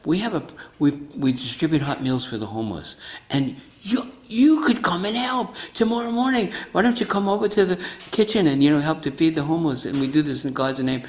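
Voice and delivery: high, bright-pitched, hammed-up recruiting pitch; eager invitation. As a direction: sell the soup kitchen with mock zeal. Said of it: "And then he goes".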